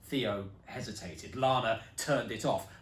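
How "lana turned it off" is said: plain reading voice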